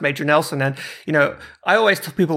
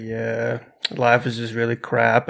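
mock gloomy; 6 semitones lower than usual. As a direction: drop the voice into glum off-air moping. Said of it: "I was like this".

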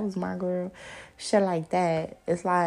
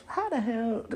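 normal talking voice

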